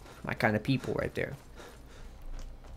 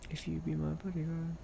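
much softer, careful "If you be my bodyguard"; soft retry; 5 semitones higher, 8 dB quieter than usual. Pretend. Streamer checking the lyric to himself, quietly. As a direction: test "If you be my bodyguard" again softly, unsure. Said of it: "Am I saying that right?".

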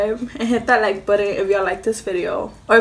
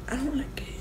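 whispering; 4 semitones higher, 11 dB quieter, faster than usual. Real, not acted. Whispered aside to camera.

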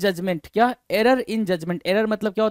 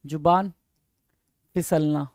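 word by word, dropping lower; dictating while writing; 4 semitones lower than usual. Real, not acted. Teacher writing out the Hindi meaning, paused dictation. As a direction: separate the words with a long pause.

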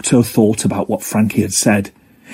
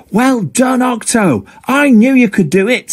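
much higher, excited friend voice; best friend congratulating Octo, much higher; Jilly, delighted; 13 semitones higher than usual. Acted. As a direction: squeal with pride for your friend.

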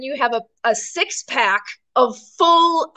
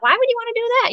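mock friends; 12 semitones higher, much faster than usual. Mocking friends' reaction.

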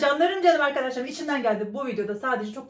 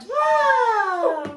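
high shouted wow; excited shout; 8 semitones higher, 4 dB louder than usual. Real, not acted. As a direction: shout it in delight. Reacting to the wheel result.

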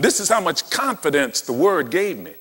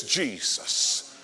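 soft whisper; 8 dB quieter than usual. Retold or imitated. Moses encouraging Jesus.

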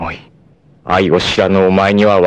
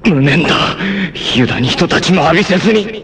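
anguished regret; 12 semitones higher, faster than usual. Acted. Dying warrior's bitter regret.

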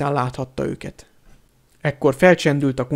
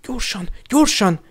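high-pitched, urgent prodding; urging; 7 semitones higher than usual. A narrator's reading. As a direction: hurry them along in a higher voice. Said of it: "sürgette őket Hermione". (sürgető, magasabb hangon)

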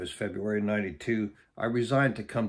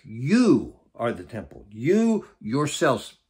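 "You" punched, slow and louder each time; emphatic; 3 dB louder, slower than usual. Shown as a demonstration. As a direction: hammer home "You" like a verdict. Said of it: "And he's very emphatic".

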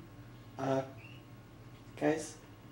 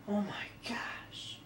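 startled whisper; 13 dB quieter, much slower than usual. Acted. Startled while sneaking.

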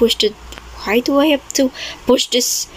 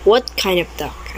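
ordinary delivery

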